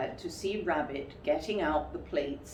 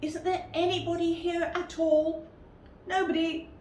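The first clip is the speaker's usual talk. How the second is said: higher story voices trading lines; higher character voices; 11 semitones higher than usual. Acted. Pooh asking and Rabbit answering, in higher voices. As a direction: play the exchange lightly.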